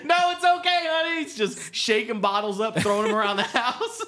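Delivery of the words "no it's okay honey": high, silly gullible husband; high mock husband voice. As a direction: play a dim husband, pitched up. Same mocked husband.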